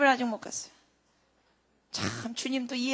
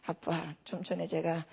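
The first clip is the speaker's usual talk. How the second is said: apologetic; 4 semitones lower, 6 dB quieter than usual. Retold or imitated. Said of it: "하나님께 죄송한지".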